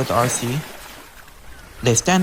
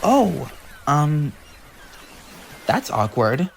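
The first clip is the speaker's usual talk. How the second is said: flustered stammer; slower than usual. Acted. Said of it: "Arcee stunned and stutter".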